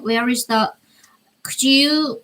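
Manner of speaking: ordinary delivery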